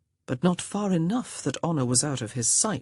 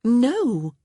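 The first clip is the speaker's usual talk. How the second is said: sharp, higher, louder no; exclaimed no; 8 semitones higher, 6 dB louder than usual. Acted. Honor answers George's jab, sharp exclamation. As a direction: blurt it out. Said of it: "she exclaimed".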